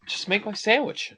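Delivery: high, put-on voice of the annoyed customer; impatient mutter. Friend voicing the impatient customer's thought, high mock voice. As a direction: toss it off like a fed-up customer.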